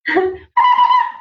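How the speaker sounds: very high trilled call. Shown as a demonstration. Cousin calling out so family can find her, high call. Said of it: "would call out using this voice".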